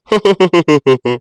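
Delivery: long mocking villain laugh, stepping downward; gloating laugh. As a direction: laugh gleefully at the fool. Crow gloats over fooled sparrow. (ஏளனச் சிரிப்பு)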